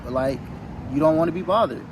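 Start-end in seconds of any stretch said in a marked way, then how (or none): none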